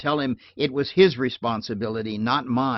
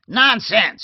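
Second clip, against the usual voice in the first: put-on high, loud, cranky old lady snapping; a loud peevish cry of disbelief; querulous outburst; 11 semitones higher, 10 dB louder than usual. A narrator's reading. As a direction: scold from across the room. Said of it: "she was saying querulously".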